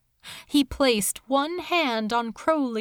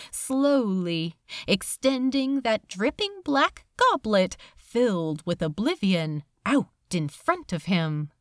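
plain reading voice

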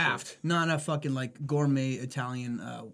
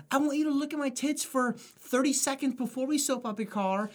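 put-on car wash girl; 8 semitones higher than usual. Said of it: "what is the point of a bikini car wash?".

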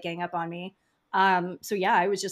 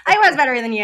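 loud, higher-pitched mock superiority; joking boast; 5 semitones higher, 12 dB louder, faster than usual. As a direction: crow it loudly as a joke. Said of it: "I'm making that joke".

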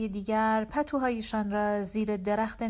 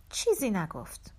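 ordinary delivery, nothing marked

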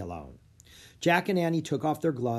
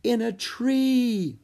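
high, loud, drawn-out excitement; thrilled, high and slow exclamation; excited stage whisper; 11 semitones higher, 5 dB louder, much slower than usual. A narrator's reading. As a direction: squeal it slowly, a whisper in name only. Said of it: "she whispered".

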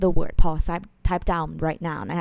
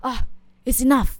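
louder, higher "it's enough" with a sigh; fed up; 5 semitones higher, 5 dB louder than usual. Shown as a demonstration. Teacher acting out being fed up, louder. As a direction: let your patience snap.

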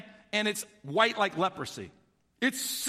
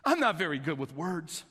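low, reluctant plea; acted meek confession of inadequacy; pleading; 6 semitones lower than usual. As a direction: plead humbly, voice lowered. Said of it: "Moses pleaded with the Lord".

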